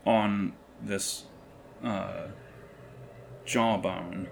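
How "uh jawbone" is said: ordinary talk, nothing marked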